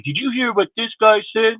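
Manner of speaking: high gossipy tattle voice; mock tattletale. Mocking viewers who tattle about a rival, high and petty.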